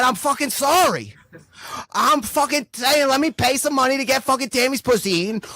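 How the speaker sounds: high, pleading whine of an excuse; whiny excuse. Retold or imitated. Excusing himself to angry Cal, high pleading whine. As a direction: beg off the blame in a high whine.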